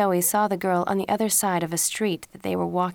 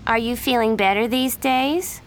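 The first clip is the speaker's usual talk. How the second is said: higher, louder fake sympathy; mock concern; 5 semitones higher, 5 dB louder than usual. A narrator's reading. Miranda taunts Jen about rumors, sugary and loud. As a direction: needle her with sugary concern.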